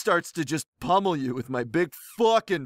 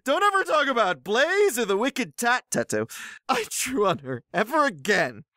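escalating anger; 10 semitones higher than usual. Acted. Poster warning a rival off his girlfriends, hammed-up escalating anger. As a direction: start cross and climb into a squealing yell. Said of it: "Well, that escalated quickly".

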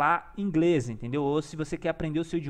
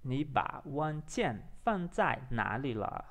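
slow, softer Mandarin repetition; careful rehearsal; 3 semitones lower, 4 dB quieter, much slower than usual. Shown as a demonstration. Learner repeating after the app, slow and careful. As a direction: spell it out word by word, unhurried.

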